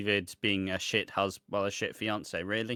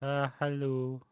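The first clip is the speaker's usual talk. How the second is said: lightly voiced groggy greeting; mock half-awake hello; just out of bed; 3 semitones higher, 4 dB quieter than usual. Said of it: "he's just rolled out of bed".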